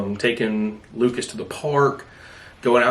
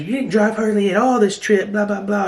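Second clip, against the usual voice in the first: high-pitched mock griping; imitated complaining; 10 semitones higher than usual. Imitating Darby griping about who drove, imitated complaining. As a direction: mimic a nagging complaint, pitched well up. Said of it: "she was complaining".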